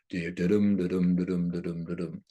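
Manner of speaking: steady "da-dum" chant, stress on "dum"; unstressed "da", stressed "dum", evenly repeated; iambic beat. Shown as a demonstration. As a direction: beat out "da-dum" with the second syllable weighted. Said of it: "he speaks in his iambic pentameter".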